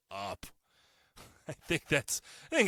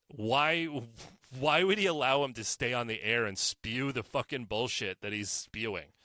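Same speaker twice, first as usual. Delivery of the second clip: ordinary talk, nothing marked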